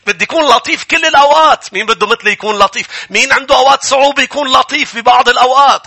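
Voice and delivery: high-pitched, insistent questions to a crowd; rallying preacher. Acted. Preacher asking the congregation to raise hands, rallying. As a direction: rouse the congregation, pitched well up.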